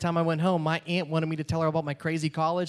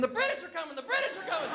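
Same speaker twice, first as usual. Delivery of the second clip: mock scream; 12 semitones higher, 4 dB quieter than usual. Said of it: "running around the airport screaming".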